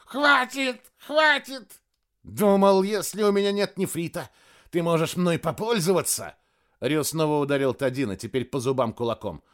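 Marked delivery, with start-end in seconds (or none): 0.0-1.8 high gurgling cries getting shriller; gurgling alien voice. A narrator's reading. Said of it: "пробулькал Тадино". 2.2-6.3 angry. Acted. Ryu furious, beating Tadino.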